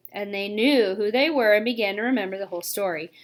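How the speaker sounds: ordinary delivery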